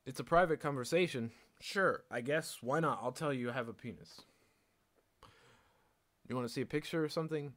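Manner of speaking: loud, high, breezy answer to a guy; tossing it off loudly and lightly; mock casual reply. Answering a guy's blunt question, loud and breezy.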